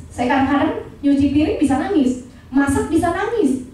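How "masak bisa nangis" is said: ordinary talk, nothing marked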